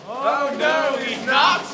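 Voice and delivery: very loud mock-argument shout; full-volume playful contradiction; panto shouted denial. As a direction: bellow a denial back at the audience. Arguing with audience in panto.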